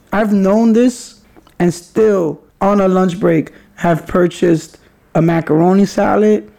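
ordinary delivery

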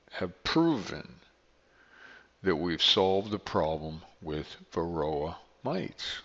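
ordinary talk

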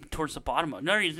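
usual speaking voice

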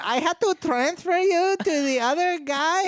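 high, slow put-on voice; mock high voice. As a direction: squeak it like a silly clerk.

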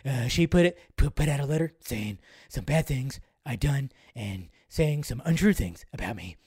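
low, hushed, raspy murmur; Joss Whedon impression. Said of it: "that Joss Whedon impression".

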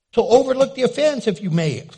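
loud laugh through the words; real laugh. Real, not acted. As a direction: chuckle it out to the crowd. Laughing aside to congregation.

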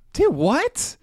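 squinting dude what. Retold or imitated. Him playing dumb to the angry coworker, squinting and high-pitched. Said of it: "you just squint and go".

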